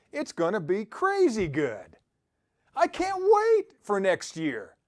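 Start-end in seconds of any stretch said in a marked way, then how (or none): 2.7-4.8 higher and louder, slowly savouring "wait"; eager excitement. Acted. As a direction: speaker hyping listeners about next year, bright eager delight. Cheering on an eager future.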